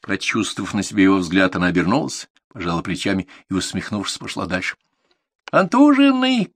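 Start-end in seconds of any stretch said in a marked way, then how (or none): 5.5-6.5 high, drawn-out taunting yell; loud high sing-out; shouted call. A narrator's reading. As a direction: holler it across the yard, stretched out. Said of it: "крикнул кто-то из четвёртого А".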